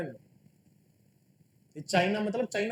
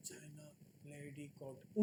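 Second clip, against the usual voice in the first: imitating a meek, barely audible mumble; mock the shy students' tiny voice; softly mimicked half-hearted recital; mock timid murmur; 9 semitones lower, 23 dB quieter, much faster than usual. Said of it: "जो ये प्यार से बोल रहे हैं ना".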